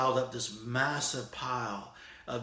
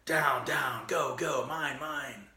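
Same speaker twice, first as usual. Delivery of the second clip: tirade; 4 semitones higher, slower than usual. Retold or imitated. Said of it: "his tirade".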